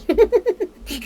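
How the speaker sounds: a sudden loud laugh of relief after being startled. Real, not acted. Startled by a weird bird outside, bursts out laughing loud. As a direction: laugh off a fright, loud and high. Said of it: "Oh, god, that scared me".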